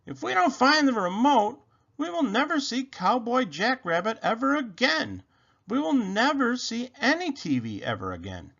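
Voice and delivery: high-pitched, distraught outburst; frantic rabbit. A narrator's reading. Rabbit despairs to Robot about missing TV, high and frantic. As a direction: keep it high, pushing toward a wail. Rabbit rants over lost remote.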